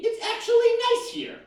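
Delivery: high, surprised posh voice; snooty Brooklyn friend. Retold or imitated. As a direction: say it like a condescending hipster. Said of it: "friends from Brooklyn call".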